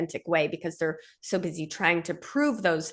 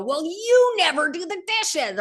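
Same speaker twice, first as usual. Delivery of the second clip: lashing out; 10 semitones higher, slower than usual. Pretend. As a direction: fire back at your wife. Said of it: "about to lash out and go".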